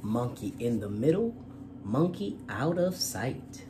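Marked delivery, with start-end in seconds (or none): none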